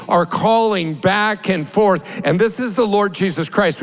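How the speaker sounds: normal talking voice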